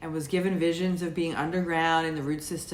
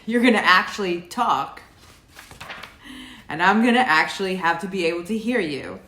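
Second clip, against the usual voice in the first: loud; 2 semitones higher, 6 dB louder than usual. Retold or imitated. Telling the spirit voice to speak up, loudly. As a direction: call it out loud so the voice has to answer. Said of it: "oh, I said".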